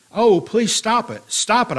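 pleading outcry, high at first then falling; crying out. A narrator's reading. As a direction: plead aloud, with mild storytelling urgency. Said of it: "I cried".

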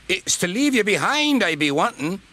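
high, indignant grumble; angry. A narrator's reading. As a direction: complain crossly in a high character voice. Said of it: "was angry".

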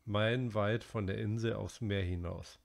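usual speaking voice

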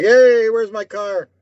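demanding caller. Retold or imitated. Duped winner calling the prankster back, mock hollering. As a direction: call out for it, stretching the words.

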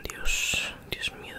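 ordinary talk

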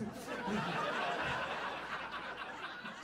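unvoiced wheezing laugh; breathy burst of laughter. Real, not acted. Laughs at croissant image.

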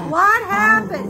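high and loud, demanding. Real, not acted. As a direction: call out what happened. Adult breaking up girls' fight.

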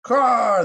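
very high, loud jay squawk; squawked caw. Acted. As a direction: screech it like an irritated bird. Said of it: "the jay squawked".

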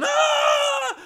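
very high, loud shriek; wife grabbed by the demon, high hammed-up scream; mock scream. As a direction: shriek as if dragged away.

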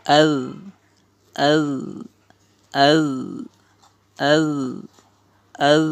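"adh" with a held, voiced dhal; "adh" repeated singly, with pauses; drilled "adh". Shown as a demonstration. Teacher shows alif zabar dhal jazm, drilling "adh". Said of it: "আলিফের জবর জালের জজম".

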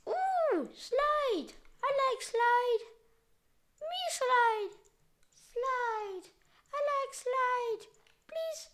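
put-on high, childish delight; high gleeful baby voice; childlike glee. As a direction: coo like a little kid. Excited about a slide.

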